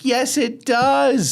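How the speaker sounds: very high, drawn-out insistence; acting out a slow, squealed rebuttal; emphatic contradiction. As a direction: stretch each word in high exasperation. Retelling a contradiction in argument.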